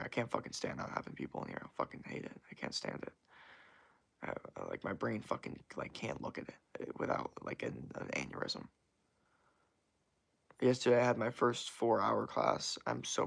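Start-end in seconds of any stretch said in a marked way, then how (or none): 0.0-8.7 frustrated mutter. Real, not acted. Frustrated at empty stream.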